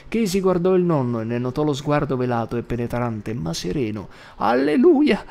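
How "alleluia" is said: very high, loud alleluia; frenzied granny shout; ecstatic cry. Acted. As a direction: cry it out in fervor. Grandma shouting praise.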